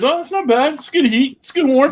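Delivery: father mocking son who says the pepper is mild. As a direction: mimic him shrugging off the heat. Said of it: "This fucker eats it, you know, licking his fingers".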